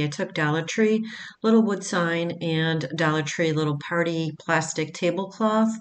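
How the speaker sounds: normal talking voice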